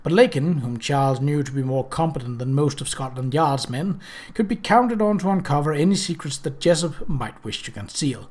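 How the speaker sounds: plain reading voice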